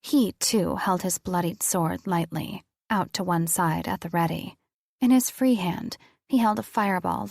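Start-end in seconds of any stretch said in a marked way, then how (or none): none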